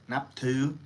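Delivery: naptu said with a Thai rising tone; naptu, Thai vowels, light aspirated t; Thai word naptu. Shown as a demonstration. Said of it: "which is respect".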